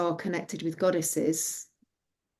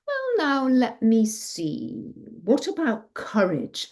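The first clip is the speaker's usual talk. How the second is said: slow, drawn-out pondering from high start; tipsy father; 5 semitones higher, slower than usual. A narrator's reading. Drunk father offering his daughter gifts, pondering slowly. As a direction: stretch the words as if fuddled by beer. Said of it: "beer gets you drunk".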